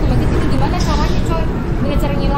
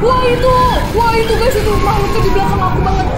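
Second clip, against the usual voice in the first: panicked yell; 6 semitones higher, 6 dB louder than usual. Real, not acted. Creature right behind.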